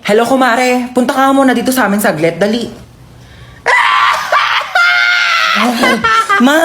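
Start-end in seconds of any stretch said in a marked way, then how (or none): 3.6-6.4 shrieking high cackle. Acted. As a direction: let out a big gossip laugh. Neighbours laughing loudly.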